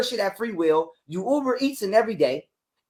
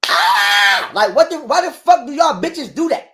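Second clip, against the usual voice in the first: loud, higher, exasperated yell; frustrated outburst; 6 semitones higher, 5 dB louder, slower than usual. Real, not acted. Venting at the host about his ex, loud and exasperated. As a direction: let out an exasperated shout.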